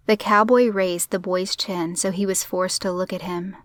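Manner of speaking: ordinary delivery, nothing marked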